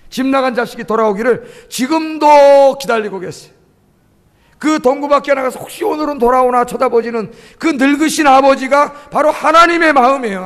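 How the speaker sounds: loud, swelling sermon delivery; fervent preaching. Real, not acted. Preacher describes father still awaiting his runaway child, fervent preaching. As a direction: build with feeling, stretching key words.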